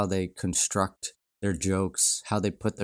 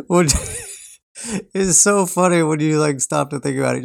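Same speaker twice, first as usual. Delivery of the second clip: laughing; 7 semitones higher, 8 dB louder than usual. Real, not acted. Laughing at the joke.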